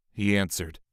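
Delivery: ordinary delivery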